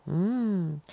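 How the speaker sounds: normal talking voice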